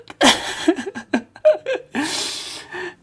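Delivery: high, loud burst of laughter; helpless shrill laughing; shrieking laugh. Real, not acted. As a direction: cackle in disbelief. Laughing after shock.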